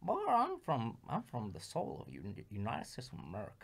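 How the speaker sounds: put-on old man Jefferson. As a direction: mumble it like an old Southern man. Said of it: "old man Jefferson".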